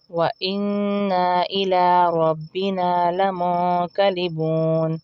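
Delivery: slow, measured Quranic recitation; recited travel dua. Shown as a demonstration. Vlogger teaching viewers the travel prayer, slow devout recitation. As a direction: chant the Arabic prayer slowly and reverently. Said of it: "تو ہم دعا کیا پڑھتے ہیں؟".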